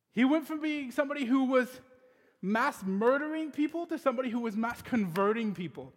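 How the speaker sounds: full preacher's cadence; preaching. Acted. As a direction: preach it to the congregation with conviction. Preaching the sermon point.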